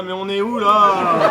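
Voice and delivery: laughing disbelief. Acted. Laughs at patient's compulsion.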